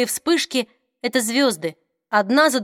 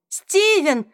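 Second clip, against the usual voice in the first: joyful cry; 7 semitones higher than usual. A narrator's reading. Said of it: "кричала она на ходу, будто ослеплённая радостью".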